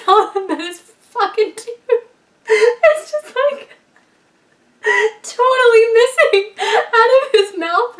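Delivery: words breaking up in high laughter; real helpless giggles, barely getting it out; laughing hard. Real, not acted. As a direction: crack up mid-sentence, voice shooting up. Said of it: "laughing so hard right now".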